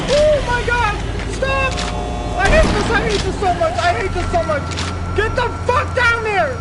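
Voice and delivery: frantic high yelling in panic; angry crying. Real, not acted. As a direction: yell in terrified frustration. Panicking while playing scary game.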